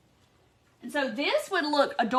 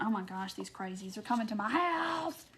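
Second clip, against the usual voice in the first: imitating Donna fretting under her breath; mock panic; 2 semitones lower, 7 dB quieter than usual. Donna dreading flea market crowds, quiet mock panic. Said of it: "she's gonna be panicking".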